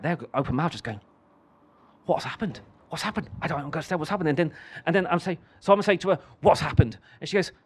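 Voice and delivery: normal talking voice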